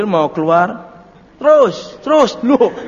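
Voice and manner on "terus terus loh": parking attendant call. Retold or imitated. Parking man waving car out.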